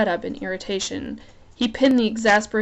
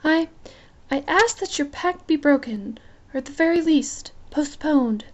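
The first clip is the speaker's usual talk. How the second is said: put-on higher, timid but brave young queen; a small queen lifting her chin, voice raised high; Isabel voice; 6 semitones higher than usual. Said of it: "gotta get my Isabel voice on".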